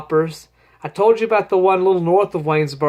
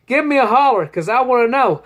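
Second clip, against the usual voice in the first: higher, louder holler to the listeners; calling out; 7 semitones higher, 4 dB louder than usual. Real, not acted. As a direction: call out for an answer.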